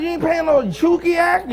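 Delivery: ordinary talk, nothing marked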